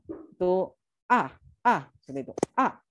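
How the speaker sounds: "A" jerked down sharply as if snapping at someone; fourth tone jerked like anger. Shown as a demonstration. Teacher shows the fourth tone as an angry snap. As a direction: bark "A" down like you're angry. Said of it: "seperti kita lagi emosi, kita marah".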